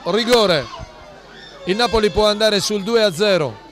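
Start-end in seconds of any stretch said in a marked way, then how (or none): 0.0-0.7 excited save call. Real, not acted. Penalty saved, live call.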